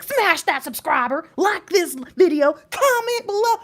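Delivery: high, loud, hammed-up influencer sing-song; mock YouTuber pitch. Mocking other YouTubers' outros, hammed-up high voice. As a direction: parody the typical YouTuber outro. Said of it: "y'all know I don't be like".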